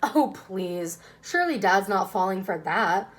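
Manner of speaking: ordinary delivery, nothing marked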